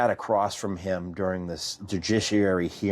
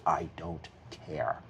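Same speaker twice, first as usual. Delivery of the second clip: cold dismissal; 4 semitones lower, 9 dB quieter, slower than usual. Retold or imitated. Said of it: "Like that".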